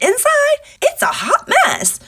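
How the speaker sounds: high, loud mock lament; rising dramatic wail. Real, not acted. Telling viewers she's a mess inside, mock wail. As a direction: wail it like a comic breakdown. Self-deprecating joke.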